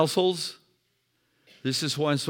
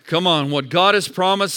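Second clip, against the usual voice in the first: usual speaking voice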